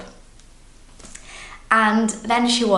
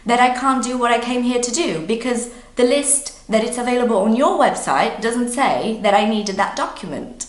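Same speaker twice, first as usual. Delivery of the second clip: retelling an exasperated, louder, higher protest; angry retort; 5 semitones higher, 4 dB louder, faster than usual. Retold or imitated. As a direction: argue it back fast and indignant, mock-outraged. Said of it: "So I was angry".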